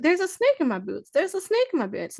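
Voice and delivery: high, chirpy pull-string toy voice; talking toy voice. Retold or imitated. Toy repeating its line.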